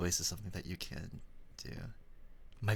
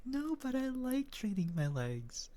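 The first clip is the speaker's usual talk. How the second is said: whiny protest; slower than usual. Retold or imitated. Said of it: "And I'm like".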